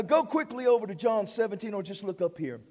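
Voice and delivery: ordinary talk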